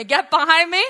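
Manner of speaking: loud repeated rebuke; congregation echo. Shown as a demonstration. Pastor and congregation repeating the rebuke loudly. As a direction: say it with the people. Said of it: "Say it with me".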